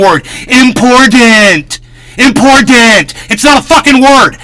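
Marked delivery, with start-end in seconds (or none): 0.5-3.1 "Important." said slow and loud with a crisp T; drawn-out, emphatic "Important." with a clear T; correct pronunciation. Shown as a demonstration. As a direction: spell out the T in "Important." loudly and slowly. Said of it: "There's no letter D in the word important".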